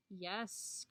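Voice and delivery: ordinary delivery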